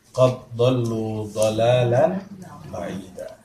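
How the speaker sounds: very slow, drawn-out recitation fading to breath; Quran recitation. Acted. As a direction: recite the verse slowly, the way it is chanted. (tilawah pelan dan panjang)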